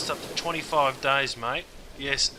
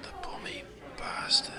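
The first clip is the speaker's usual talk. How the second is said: high hushed whisper; whispered insult; 12 semitones higher, 8 dB quieter than usual. Acted. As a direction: spit the insult under your breath. Muttered insult after rant.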